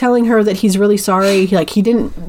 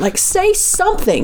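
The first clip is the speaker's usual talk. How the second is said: retold yelling; 11 semitones higher than usual. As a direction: imitate yelling for a response, slowly and high. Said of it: "yelling at her all of these things".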